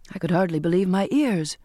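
plain reading voice

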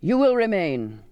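cold commanding captain; stern captain. A narrator's reading. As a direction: order her to stay. Captain commands narrator to stay.

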